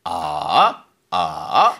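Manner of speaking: "아" dipping very low then coming up; Mandarin third tone. Shown as a demonstration. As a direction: drop "아" to the floor and lift it. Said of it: "삼성은 앉았다, 일어나".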